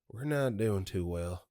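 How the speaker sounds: slow, downcast country drawl; sad country accent. Retold or imitated. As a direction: imitate a dejected man with a country accent. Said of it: "in a country accent, responded sadly with".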